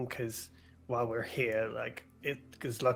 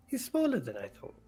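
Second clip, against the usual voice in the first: Toby; 6 semitones higher than usual. Acted. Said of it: "Toby just goes".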